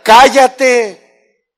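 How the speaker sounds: sudden loud, high shouted order. Shown as a demonstration. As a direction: yell it to cut everyone off. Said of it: "Entonces tengo que gritar". (grito fuerte y agudo)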